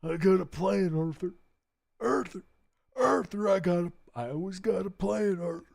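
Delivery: high, strained attempt at an outlaw boss voice; badly faked gang leader, pushed up high; Dutch. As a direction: strain for a rumbly voice and miss. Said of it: "I can't do a Dutch voice to save my life".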